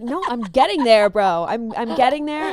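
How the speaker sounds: loud, repeated, fed-up reply; brushing off nagging friends loudly; exasperated insistence. Retold or imitated. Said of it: "I was like".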